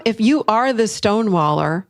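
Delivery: ordinary delivery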